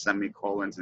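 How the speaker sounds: ordinary talk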